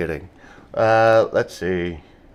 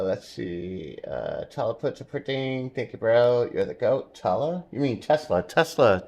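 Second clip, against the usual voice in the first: ordinary delivery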